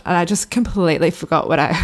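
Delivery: normal talking voice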